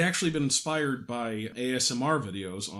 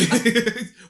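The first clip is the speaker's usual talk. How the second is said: bright burst of laughter, high and loud; real laugh; 10 semitones higher, 9 dB louder than usual. Real, not acted. Host cracking up at his own blame joke, laughing loudly.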